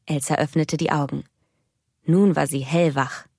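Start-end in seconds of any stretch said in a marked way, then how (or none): none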